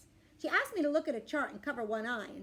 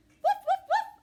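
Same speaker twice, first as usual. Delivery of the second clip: three high, loud woofs; dog barks; 10 dB louder, slower than usual. Acted. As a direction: bark back in short high bursts. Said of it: "I say".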